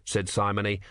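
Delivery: usual speaking voice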